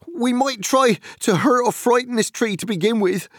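high, loud, fierce resolve. Acted. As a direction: spit it out fiercely. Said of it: "said Sam fiercely".

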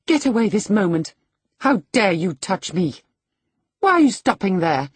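loud, high-pitched outburst of disgust; disgusted cry. A narrator's reading. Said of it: "she cried in a tone of disgust".

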